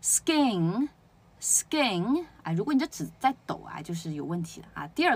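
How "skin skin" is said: skin twice as "sgin", higher, spaced; skin, drawn out, no puff; unaspirated k. Shown as a demonstration. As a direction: model skin for repetition with a g-like k. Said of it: "都念一下哦".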